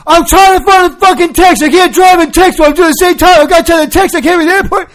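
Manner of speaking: high, loud frantic rant at the cop; shouting at a cop through the car window; frantic yell. Retold or imitated. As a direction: yell it like you're losing it over a text. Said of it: "I'm like".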